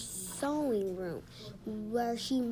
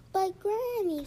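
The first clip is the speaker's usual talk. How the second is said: higher-pitched pleading objection; child's protest; 7 semitones higher, 4 dB louder than usual. A narrator's reading. Patrick objects to going to sleep, protesting. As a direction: plead with Granny, pitch raised.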